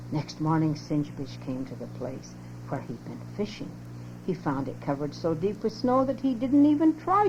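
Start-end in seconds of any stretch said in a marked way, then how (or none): none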